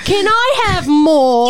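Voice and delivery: high, drawn-out mock British orphan; British accent. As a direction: plead slowly and loudly in hammed-up British. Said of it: "do your British".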